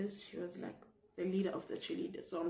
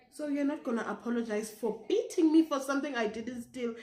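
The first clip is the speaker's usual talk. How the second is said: indignant retort; 5 semitones higher, 6 dB louder than usual. Retold or imitated. Her indignant retort to the teacher.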